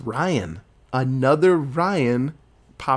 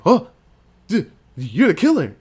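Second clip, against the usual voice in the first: mock gasp, stammered accusation; higher, imitated shock of recognition; startled accusation; 5 semitones higher, 5 dB louder than usual. Tree runs into Lori at the elevator, mock-startled. Retold shock on meeting supposed killer.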